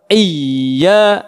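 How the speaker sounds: "iya" with an exaggerated dip and swing in pitch; too extreme. Shown as a demonstration. Teacher shows what to avoid, "iya" with extreme intonation. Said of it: "jangan ekstrim".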